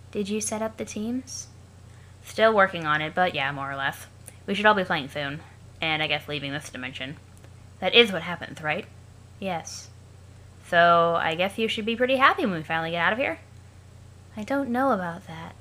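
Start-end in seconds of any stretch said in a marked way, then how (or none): 0.1-1.5 flat as if long dead. Acted. [name] asks [name] about teams, in a dead monotone. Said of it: "that's how I'll be reading Aradia". 9.4-9.9 flat as if long dead. Acted. [name] asks [name] about teams, in a dead monotone. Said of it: "that's how I'll be reading Aradia". 14.4-15.5 flat as if long dead. Acted. [name] asks [name] about teams, in a dead monotone. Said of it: "that's how I'll be reading Aradia".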